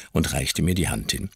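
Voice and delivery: plain reading voice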